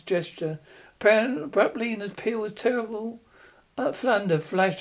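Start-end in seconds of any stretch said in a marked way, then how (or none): none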